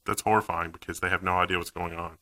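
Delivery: ordinary talk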